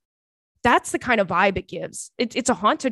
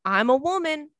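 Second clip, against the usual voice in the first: higher, sheepish quip; awkward excuse; 6 semitones higher than usual. Retold or imitated. Explaining her groceries to a man, sheepish quip. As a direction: toss it off like it explains everything. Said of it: "I go".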